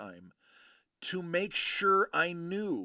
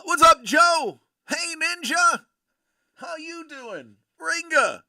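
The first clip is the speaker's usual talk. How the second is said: loud greetings; 12 semitones higher, 12 dB louder than usual. Real, not acted. Greeting chat viewers loudly.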